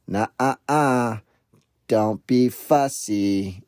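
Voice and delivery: put-on drawn-out singsong telling-off of a toddler; old lady scolding. Little old lady to a stranger's fussy son, mild scolding. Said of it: "this little old lady on a".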